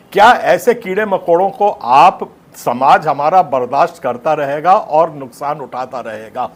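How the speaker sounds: normal talking voice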